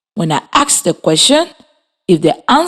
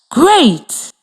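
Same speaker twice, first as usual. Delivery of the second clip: ordinary delivery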